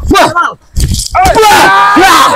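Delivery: fight cry. Acted. Martial arts fight cries.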